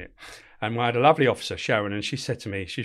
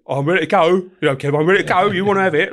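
louder, higher, itching for a fight; aggressive challenge; 6 semitones higher, 8 dB louder than usual. Retold or imitated. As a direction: square up like a cocky young boxer. Aggressive prisoner quoted, fists up.

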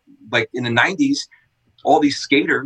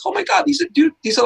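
starstruck excitement; 14 semitones higher, faster than usual. Retold or imitated. Starstruck by celebrities at shop.

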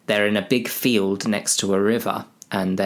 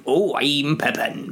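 slow, higher comic voice; Pippin; 5 semitones higher than usual. Acted. Pippin introduces himself, slow silly voice. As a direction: draw it out in a silly voice.

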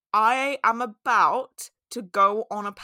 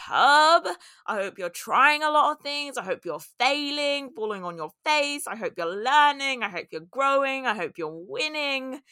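rousing cheer; 6 semitones higher, 4 dB louder than usual. Real, not acted. Rousing list of wishes for listeners.

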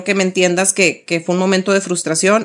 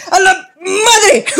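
exasperated outburst; 10 semitones higher, 4 dB louder, much slower than usual. Retold or imitated. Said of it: "decisión de cinco segundos".

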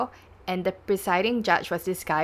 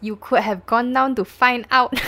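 loud, raised reproach in court; questioning prosecutor; 5 semitones higher, 7 dB louder than usual. Acted. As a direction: throw it at the witness as a rebuke. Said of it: "the prosecution".

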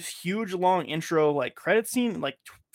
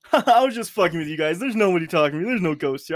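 mocking gloat; 3 semitones higher, 6 dB louder than usual. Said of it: "the Gemma girl's like".

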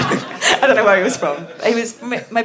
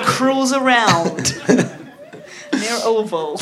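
slow mock cruise call; much slower than usual. Playfully acting like the driver.

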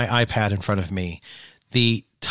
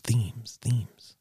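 fading echo; 8 dB quieter than usual. Pretend. Echo fading away to a whisper.

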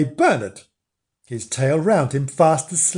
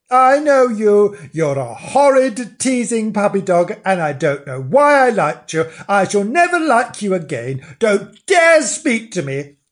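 indignant scolding; 6 semitones higher, 4 dB louder than usual. Acted. Bernard telling Frank off for teasing, indignant. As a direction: scold in wounded outrage.

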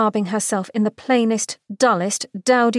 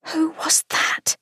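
hushed whisper; 8 semitones higher, slower than usual. A narrator's reading. Narrator hushes in shock.

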